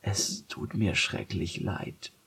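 soft, slow guilty whisper. Acted. The cat apologising to her friends, soft whisper. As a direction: breathe out the apology. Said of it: "flüsterte Macadamia".

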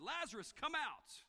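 Jesus shouting into the grave, loud and high. Retold or imitated. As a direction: call the dead man out of the tomb. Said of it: "Then Jesus shouted".

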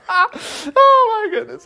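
high-pitched, laughing exclamation. Real, not acted. Preacher recovering from his own rafting joke, laughing. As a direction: catch your breath through real laughter.

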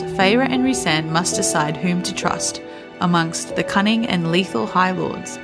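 ordinary talk

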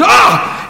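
short, high-pitched comic yell; startled scream. Retold or imitated. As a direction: imitate a kid screaming at being caught. Kid's startled cry, acted out.

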